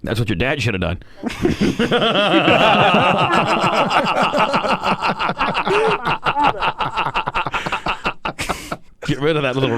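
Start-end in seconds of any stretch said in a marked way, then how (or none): 1.1-8.8 long rising laugh; cracking up. Real, not acted.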